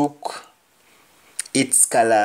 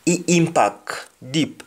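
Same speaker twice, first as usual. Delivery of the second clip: patois, raised pitch; Jamaican patois; 5 semitones higher than usual. Shown as a demonstration. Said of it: "Somebody says".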